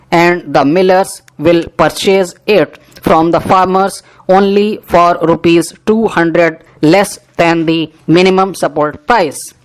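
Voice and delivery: ordinary talk, nothing marked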